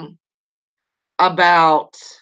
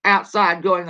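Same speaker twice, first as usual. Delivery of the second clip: ordinary talk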